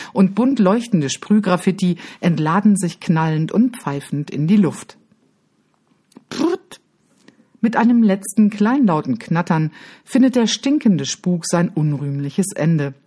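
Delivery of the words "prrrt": fizzle noise. Retold or imitated. Fizzling last rocket.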